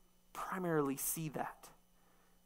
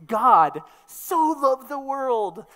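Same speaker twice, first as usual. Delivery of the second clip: much higher, louder, slow with so stretched; degree reading; 7 semitones higher, 14 dB louder, slower than usual. Shown as a demonstration. Preacher showing the popular reading, high, loud, swooning. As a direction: swoon on the word so. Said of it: "That's how we understand those things".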